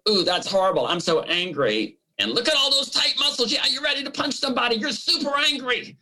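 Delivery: high, fast, worked-up voice of an angry brain. Retold or imitated. Therapist voicing the brain to her host, high and fast worked-up. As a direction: play the brain winding itself up into rage.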